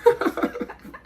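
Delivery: laugh. Real, not acted. Interviewer quoting the guest's own video line, amused laugh.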